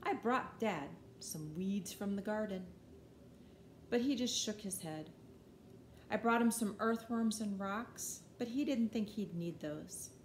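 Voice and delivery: ordinary delivery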